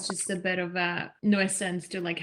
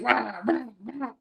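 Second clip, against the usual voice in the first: imitating gruff Beijing rhotic growl; rolled-R "Rah" in mock Beijing Mandarin; rough Beijing-style growl; mock Beijing accent. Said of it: "the Beijing accent, which is like".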